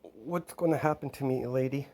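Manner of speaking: put-on high, soft little girl's voice; a lost kid asking quietly; worried child. Orphaned girl asking Lilith about her future, soft. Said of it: "And she's like".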